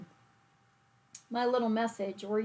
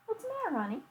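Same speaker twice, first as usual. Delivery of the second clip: high, sweet voice to the dog; pet talk; 12 semitones higher, much faster than usual. Real, not acted. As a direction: coo at a worried pet. Talking to her dog mid-craft.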